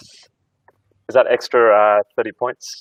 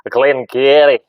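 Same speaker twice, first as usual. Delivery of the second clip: loud, higher, put-on Scottish accent; best Scottish accent; 4 semitones higher, 11 dB louder, much slower than usual. Panellist guessing a whisky name, hammed-up Scottish accent. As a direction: go as Scottish as you possibly can. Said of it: "put on your best Scottish accent".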